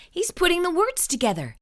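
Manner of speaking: ordinary delivery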